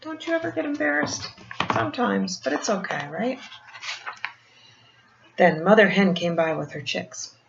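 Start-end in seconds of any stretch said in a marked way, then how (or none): none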